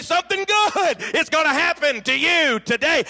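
a high call that climbs at the end; rising preacher's call. Retold or imitated. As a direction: peak the call to the crowd. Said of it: "he'd say".

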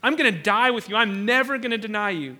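forceful pledge with punched "die" and "never"; emphatic vow. Acted. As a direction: hit "never" hard, swearing loyalty. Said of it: "Peter emphatically".

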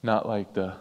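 ordinary talk

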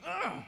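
a high, loud gruff grunt; loud high tough-guy grunt. Retold or imitated. Gang leader grunts at preacher.

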